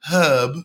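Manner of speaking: "herb" with a sounded h; British "herb", breathe the h at the start; British pronunciation. Shown as a demonstration. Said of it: "learning English from a British person".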